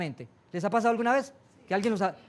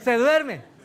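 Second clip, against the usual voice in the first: higher and louder startled question; surprised; 5 semitones higher, 8 dB louder, much slower than usual. Real, not acted. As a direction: react in disbelief. Surprised reply. (sorpresa, más alto y fuerte)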